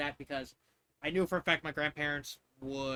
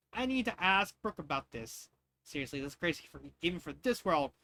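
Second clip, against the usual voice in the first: usual speaking voice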